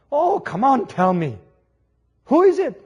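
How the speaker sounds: loud, high playful insistence; eager pressing. Acted. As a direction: coax the answer out of her daughter. Grandmother pressing for news.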